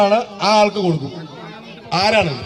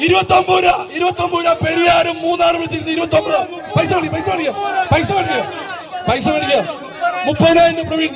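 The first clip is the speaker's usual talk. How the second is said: auctioneer; 6 semitones higher than usual. Acted. Rising bids called fast.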